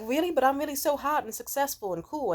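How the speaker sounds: high, loud mock protest; incredulous protest. Rejected male model pleading his case, incredulous protest. Acting the vain suitor's protest.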